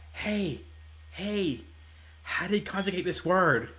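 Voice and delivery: quiet imitated whisper; retold whisper. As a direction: hush it like in class. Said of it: "I was trying to whisper".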